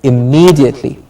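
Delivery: "immediately" with a held MEE; long E. Shown as a demonstration. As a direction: say "immediately" stretching the stressed E. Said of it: "Long E".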